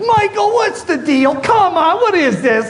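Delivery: a put-upon coworker asking what's going on; mock complaining colleague. Colleague baffled by the mask, mock whiny.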